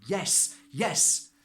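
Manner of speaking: high, loud, thrilled double yes; eager yes. Retold or imitated. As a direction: jump at the offer. Eagerly accepting property offer.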